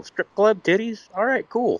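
giddy at the strip club idea; eager excitement. Retold or imitated. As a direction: voice leaps high with glee. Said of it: "I was like".

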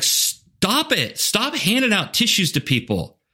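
high, pleading mock exasperation; silently begging them to stop; exasperated inner voice. Coach watching a leader hand out tissues, exasperated and high.

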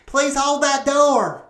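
calling out. Acted. Heavy lady calls out.

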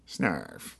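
N=Snarf/character. Retold or imitated. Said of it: "he was Snarf".

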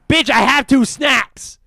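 high, very loud, slow furious yell; mad outburst. Acted. Kid to snack server refusing juice, furious shout. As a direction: explode at the refusal, each word drawn out. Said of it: "I was so mad".

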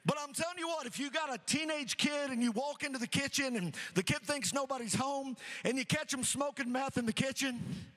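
normal talking voice

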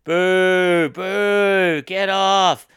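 long, high, loud jeers; imitated crowd booing. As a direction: boo the act off like a hostile crowd. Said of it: "it was just them going".

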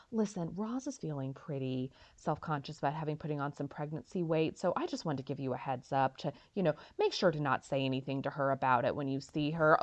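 soft, confidential phone aside; quiet secret warning; hushed aside. Retold or imitated. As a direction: keep it low so Roz won't hear. Frasier warning mom secretly.

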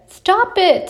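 high, urgent storybook objection; sharp protest. A narrator's reading. Big Bear to his wobbling brother, protesting.

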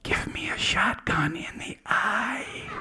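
conspiratorial slow whisper; hushed, sly creature whisper; whispered owl request. Retold or imitated. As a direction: lean in close and whisper the secret. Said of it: "the owl moved really close to me and said".

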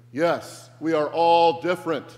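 very loud mock unison chant; crowd speaking together. The mob replies to Brian all together, chanting loudly. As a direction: chant it back as an obedient mob. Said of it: "they all say together".

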